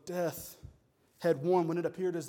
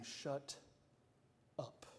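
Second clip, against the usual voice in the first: understated, softer blunt shut up; mild blunt hush; 3 semitones lower, 13 dB quieter than usual. Retold or imitated. God to the raging world, softly blunt. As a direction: drop it low, a curt shut up. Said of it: "in our modern vernacular".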